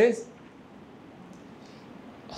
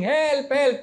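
higher mock call for help; 7 semitones higher than usual. Bound man shouting to a friend with headphones, higher call. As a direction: call out to him, pitch up.

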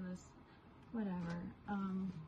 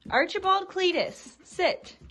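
full-name scolding; 9 semitones higher, 11 dB louder, slower than usual. Real, not acted.

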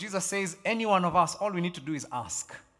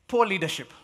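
Poor leadership, stressing poor, raised; louder announced point; 3 semitones higher, 6 dB louder than usual. Shown as a demonstration. Pastor announcing a point to the church, raised. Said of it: "I've got two more to go".

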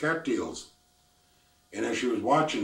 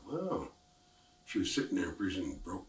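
usual speaking voice

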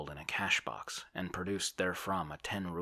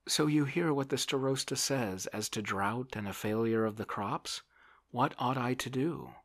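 high, helpless appeal; raised, fretful character voice; plaintive landowner; 8 semitones higher, 6 dB louder than usual. A narrator's reading. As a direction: play a worried man begging for guidance. Oblomov asks for help.

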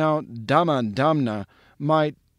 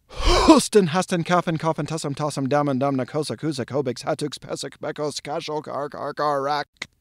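huffed, then rapid-fire. Shown as a demonstration. Performing for live audiences, huffed start then as fast as possible. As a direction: huff out the first word, race through the rest on that breath. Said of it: "huff out that first H ... as fast as I can".

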